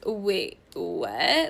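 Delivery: imitating someone taken aback; retold shock. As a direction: act out a halting, shocked question. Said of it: "she's, like, really shocked".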